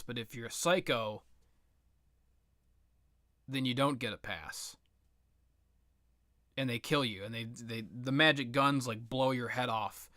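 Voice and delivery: ordinary talk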